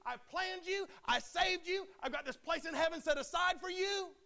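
God rebuking the one who loves him back, as an indignant cry; wounded anger. Acted. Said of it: "He says".

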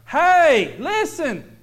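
sudden loud, high commanding call; God's stern call. Retold or imitated. God silencing the complaining father, loud command. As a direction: stop him cold with a shout. God voiced answering the preacher.

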